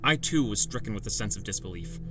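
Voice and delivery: ordinary delivery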